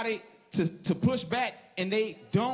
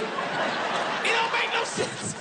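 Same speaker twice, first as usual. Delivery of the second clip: much higher, slow, drawn-out shout; exasperated outburst; 14 semitones higher, much slower than usual. Real, not acted. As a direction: stretch the punchline in disbelief. Exasperated punchline on dad's advice.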